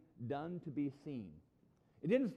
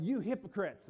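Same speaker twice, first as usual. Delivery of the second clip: raised, higher voice in sharp rebuke; worked-up rebuke; 6 semitones higher, 6 dB louder than usual. Acted. Jesus to the Pharisees while disciples look on, worked-up rebuke. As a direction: rail at them, loud and high. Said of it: "maybe even yelling when he said".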